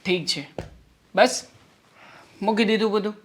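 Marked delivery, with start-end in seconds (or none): 1.1-3.1 exasperated give-in. Acted. Husband giving up on work.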